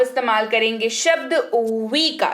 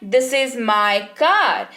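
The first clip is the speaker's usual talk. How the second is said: this is my car, each word drawn out very slowly; very slow pronunciation; much slower than usual. Shown as a demonstration. Teacher carefully modelling English, very slow. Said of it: "आपको उच्चारण वाले शब्द पर ध्यान देना है".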